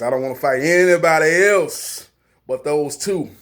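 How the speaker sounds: loosely imitated star, emphatic and drawn-out; lightly put-on celebrity voice, digging in; Charles Barkley. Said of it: "in my Charles Barkley voice".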